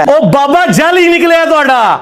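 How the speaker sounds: slow, high, laughing jeer; laughing mockery. Real, not acted. Jeering at rivals that their saint is fake, slow laughing.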